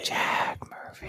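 slow, hushed whisper. Pretend. Cohost whispering the name slowly back.

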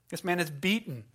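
usual speaking voice

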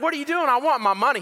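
high-pitched mock protest; retold indignant teenage demand; cocky demand. Taunting the departing loser for money.

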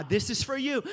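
ordinary delivery

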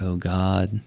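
normal talking voice

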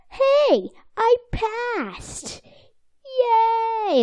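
high, excited cartoon fish voice, badly done; bad Mister Lawrence impression. Recapping the episode to co-host, high mock-cheerful fish voice. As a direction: squeal it like a giddy cartoon fish. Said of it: "really bad SpongeBob impression".

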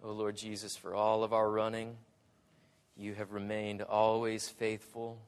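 low, soft voice of prayer. Real, not acted. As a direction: address the Lord quietly, phrase by phrase. Leads closing prayer.

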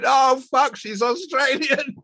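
very high, slowing squeal of mock horror; retold dismay. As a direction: squeal the awful realisation, nearly laughing. Retold shock at own mistake.

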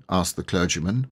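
ordinary delivery, nothing marked

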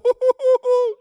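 sudden high burst of laughter; real laugh. Real, not acted. Pastor laughing at his delivery-driver joke, high bursting laugh. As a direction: crack up at your own joke.

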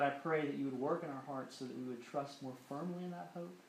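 soft, hushed pastoral prayer; a pastor praying softly to close the sermon; closing prayer. Acted. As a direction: pray quietly over the room.

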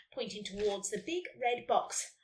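usual speaking voice

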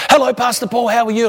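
high, hearty one-volume greeting; imitating loud talker. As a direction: call out a greeting, mimicking an eager congregant. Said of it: "talk at one volume loud".

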